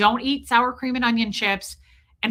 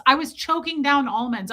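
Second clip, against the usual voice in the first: ordinary talk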